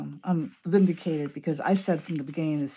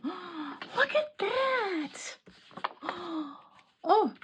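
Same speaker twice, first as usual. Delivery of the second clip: gasp, then a much higher, very slow look at that; gasping wonder; 12 semitones higher, much slower than usual. Real, not acted. Crafter seeing her box fit, gasping and amazed. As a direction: breathe it out in delighted awe.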